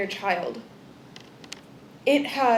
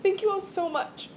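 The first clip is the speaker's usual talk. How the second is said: tearful; 5 semitones higher, 8 dB quieter than usual. Real, not acted. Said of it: "not cry even more".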